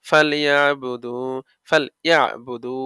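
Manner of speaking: ফালইয়াবুদু slowly recited, final "du" held long; recited one-alif stretch. Shown as a demonstration. Teacher demonstrating madd to students, slow stretched recitation. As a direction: draw out the last vowel of ফালইয়াবুদু. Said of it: "এক আলিফ মাদে আসলি".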